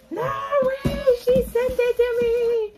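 high thrilled squeal; excited squeal. Real, not acted. Overjoyed at a surprise.

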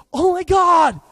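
very high, very loud, drawn-out yell; animated alarm shout. Retold or imitated. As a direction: broadcast it to the whole hallway. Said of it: "Marcus is super animated".

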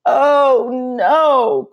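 high, loud dismayed cry; boy's dismay. Acted. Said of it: "cried Pablo".

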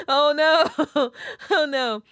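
loud, high, through real laughter; helpless laughing protest; laughing dismay. Real, not acted. Reader cracking up halfway through a birthday message, laughing.

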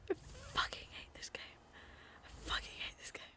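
hushed whisper. Real, not acted. In the dark game, still whispering in dread. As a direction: breathe it out in dread.

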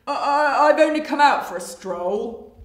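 loud, stammering crocodile bluff; lying crocodile. Acted. Crocodile excusing himself to flamingos, stammering lie. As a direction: stammer loudly, covering up the lie.

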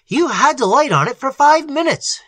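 high, loud, excited outburst; excited shout. A narrator's reading. Algernon shouting at Little about the failed light, excited. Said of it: "shouted Algernon".

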